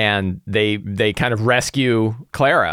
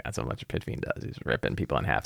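near-whispered, much softer deadpan answer; hushed reply; 9 semitones lower, 13 dB quieter, faster than usual. Retold or imitated. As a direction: drop almost to a whisper, matter-of-fact. GM answers players flatly.

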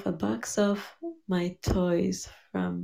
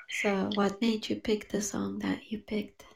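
usual speaking voice

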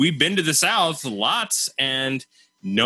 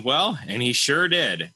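ordinary talk

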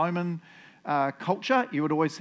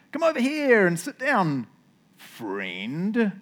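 feigned friendliness; 5 semitones higher, much slower than usual. Acted. Boaz calls over a rival he dislikes, feigned friendliness. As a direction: hail someone you dislike as friend. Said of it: "I don't think that Boaz likes this guy".

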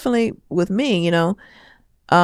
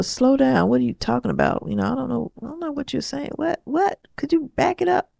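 high, softer, puzzled pleading; mock bewildered complaint; 7 semitones higher, 4 dB quieter than usual. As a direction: act lost, voice climbing higher. Retelling complaint to fast-talking daughter.

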